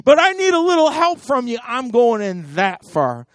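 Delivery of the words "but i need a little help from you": high, loud desperate cry upward; pleading shout to heaven; yelling to God. Retold or imitated. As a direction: call out to God for help. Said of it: "I wanna have to yell to God".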